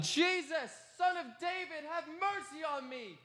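high, pleading cry for mercy; desperate shout. Retold or imitated. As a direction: call out over the crowd, begging. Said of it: "and I shouted".

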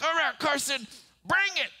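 high, tense challenge to his son; bracing dare. Retold or imitated. Father daring his six-year-old son to strike. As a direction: brace yourself and dare him. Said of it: "I'm like".

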